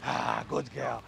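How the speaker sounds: hushed, low approval; soothing praise. Real, not acted. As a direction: settle the dog with gentle praise. Praising the dog after commands.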